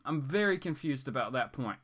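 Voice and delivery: ordinary talk, nothing marked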